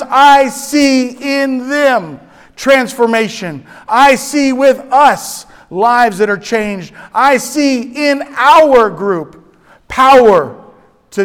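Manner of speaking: loud, rising sermon delivery, slowing down; fervent preaching. Acted. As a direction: preach the psalm with full force. Preaching the psalm aloud.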